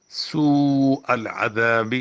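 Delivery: long drawn madd on سوء. Shown as a demonstration. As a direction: hold the long vowel of سوء. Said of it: "سین کو لمبا کیوں کیا گیا زیادہ".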